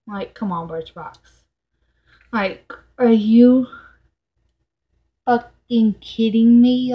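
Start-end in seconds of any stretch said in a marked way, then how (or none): 2.3-6.9 loud, slow, real disbelief; pissed off. Real, not acted. Vlogger fed up with her beauty box, pissed off. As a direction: space it out and punch the swearing. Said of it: "literally getting pissed the fuck off".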